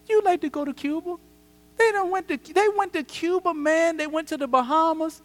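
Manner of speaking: the tempter taunts the pastor about others' trips, high and needling; tempting voice. Retold or imitated. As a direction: taunt him like the devil on his shoulder. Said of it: "it says this".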